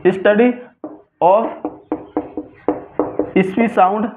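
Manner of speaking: word by word, long pauses between; slow dictation. Real, not acted. Teacher writing out a definition for students, slow dictation. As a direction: dictate it in separate chunks for note-taking.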